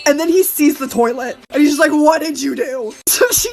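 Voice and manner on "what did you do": lower, slow, drawn-out question; low-pitched mock disbelief; appalled house owner. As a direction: imitate a dismayed man, low and slow.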